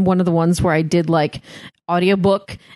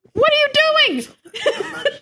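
squeaky laughing question; high surprised laugh; much slower than usual. Real, not acted. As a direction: shriek it out, amused. Reacts to odd sound on call.

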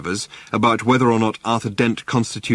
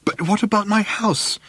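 plaintive; 11 semitones higher than usual. A narrator's reading. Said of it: "he asked plaintively".

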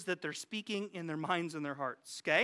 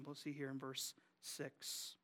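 ordinary delivery

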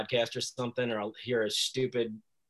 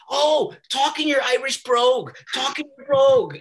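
hammed-up mother showing off her child; imitated pushy mom; 13 semitones higher, 12 dB louder than usual. Mom making him perform for her friends, loud and insistent. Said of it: "my mom used to always make me".

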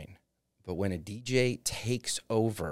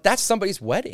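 exasperated; 10 semitones higher, 5 dB louder, faster than usual. Retold or imitated. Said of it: "And I'm like".

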